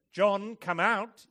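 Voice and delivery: loud, high, slow call; mock command. Preacher imagines summoning the dead at a funeral, mock loud command. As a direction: imitate a booming summons, stretched out.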